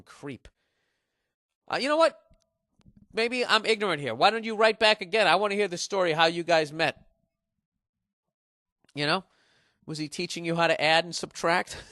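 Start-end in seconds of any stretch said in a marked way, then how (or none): 1.7-10.8 loud sarcastic challenge; mocking shout. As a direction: call him out loudly. Mock challenge to the creep.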